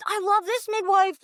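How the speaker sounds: high, pained plea against the advice; begging not to lose her midwife; pleading protest. Retold or imitated. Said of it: "I fought her on it".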